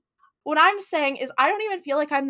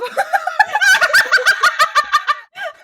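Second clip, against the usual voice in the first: long, high, loud cackle; shrieking laughter; 8 dB louder than usual. Real, not acted. Co-host cracking up at own pun, shrieking laughter. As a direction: lose it laughing at top pitch.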